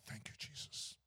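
soft, breathy whisper of thanks; slow hushed prayer of gratitude. Retold or imitated. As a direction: whisper it like a dying man in pain. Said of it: "he just said".

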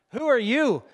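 loud, high-pitched demand; sharp challenge. Retold or imitated. Gate lady turns around to late passenger, loud challenge. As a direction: imitate an agent challenging a stranger loudly.

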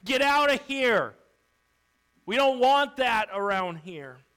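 loud shout, high at the start; hostile shout. Acted. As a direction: shout to drive someone away. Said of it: "threw something at him and shouted".

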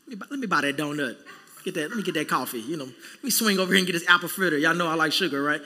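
tempted self. Retold or imitated. Mimicking himself spending cash.

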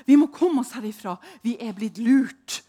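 loud, urgent call to get out; urgent shout. Retold or imitated. To the others lying on the floor, a loud urgent shout. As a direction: yell at the others to flee.

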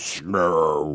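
deep, slow dopey giant; low booming giant grunt. Retold or imitated. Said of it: "the giant says".

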